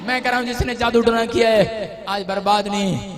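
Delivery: loud, rising sermon proclamation; fiery pulpit declaration; full-force preaching. Acted. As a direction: preach it out to the whole hall.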